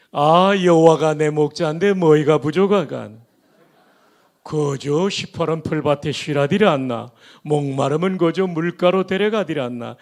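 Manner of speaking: 평안도 사투리로 힘있게; Pyongan dialect. Shown as a demonstration. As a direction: proclaim it like a northern Pyongan grandfather. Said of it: "평안도 버전입니다".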